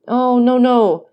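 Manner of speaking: loud, high protesting cry; cried out. A narrator's reading. Dantes crying out to the old prisoner, loud and high. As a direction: shout the denial, pleading to be believed. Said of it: "cried Dantes".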